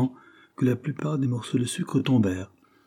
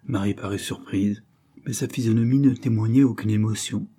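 ordinary delivery, nothing marked

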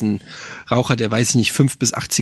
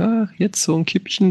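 higher, wistful sigh of craving; imagined craving, sighed out high; longing for a smoke; 6 semitones higher than usual. Retold or imitated. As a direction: dream of a little cigarette, pitched up. Craving a cigarette, inner thought.

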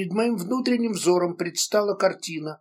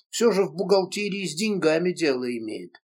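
ordinary delivery, nothing marked

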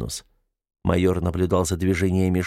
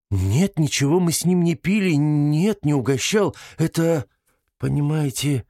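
high, protesting, faltering woman's voice; embarrassed detained woman; 6 semitones higher than usual. A narrator's reading. Detained woman denies drinking with her visitor, flustered. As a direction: deny it, then falter in embarrassment.

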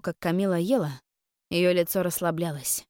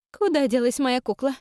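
put-on higher, petulant little girl; whiny child; 7 semitones higher, 4 dB louder than usual. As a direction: whine like a spoiled child. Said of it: "капризно протянула".

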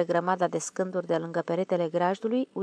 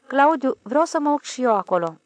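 put-on loud, high child's plea; insistent raised wheedling; wheedling request; 5 semitones higher, 8 dB louder than usual. A narrator's reading. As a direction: beg like a child asking Dad for treats. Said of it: "Vorbea ca și atunci când cerea de la tata smochine".

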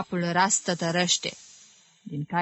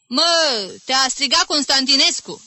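high, loud schoolboy call; calling from below; 7 semitones higher, 6 dB louder than usual. Acted. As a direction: yell across the room to a classmate. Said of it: "îl strigă de jos". (strigăt de elev, sus și tare)

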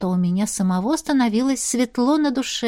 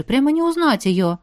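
ordinary delivery, nothing marked

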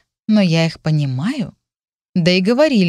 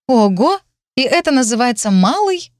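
astonished; 7 semitones higher, 3 dB louder than usual. Acted. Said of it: "Ого! exclaimed in astonishment".

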